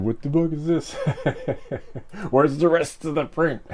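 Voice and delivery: laughing through a mock complaint as Bob; giggling fake indignation; mock outraged Bob. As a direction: play Bob as offended, cracking up while saying it. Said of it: "He's like".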